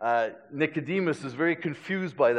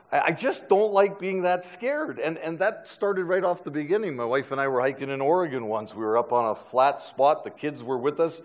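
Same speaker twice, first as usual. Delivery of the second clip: ordinary talk, nothing marked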